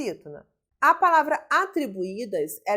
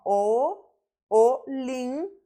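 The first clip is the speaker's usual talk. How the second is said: "olimpiada" drawn out syllable by syllable; split into syllables; 2 semitones higher than usual. Shown as a demonstration. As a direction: break "olimpiada" into slow, separate syllables with gaps. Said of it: "porque separa a sílaba".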